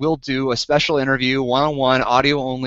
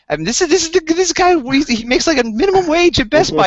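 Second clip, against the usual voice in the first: high-pitched, louder disbelief; incredulous rant; 12 semitones higher, 4 dB louder than usual. Real, not acted. Outraged rant about salesman.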